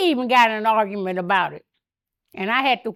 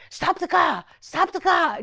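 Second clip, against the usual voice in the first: imitated passenger, higher pitched; high, urgent mock order; mock frantic demand; 5 semitones higher than usual. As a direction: imitate someone demanding a halt. Imitates angry passenger demanding stop.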